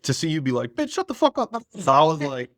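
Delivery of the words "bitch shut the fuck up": high squeaky mock snap at someone; mock bratty teen snap. Friend's teenage self telling a boy off, high mock snap. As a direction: imitate a mouthy teen telling someone off, pitched way up.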